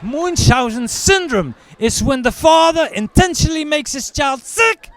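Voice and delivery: loud, high explaining; pompous official. Retold or imitated. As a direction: lecture him stiffly.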